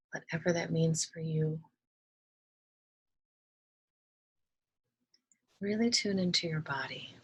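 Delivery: calm, soft meditation voice with long pauses; unhurried, hushed instructions, leaving space to breathe; guided meditation. Acted. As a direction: gently guide listeners through relaxing each body part.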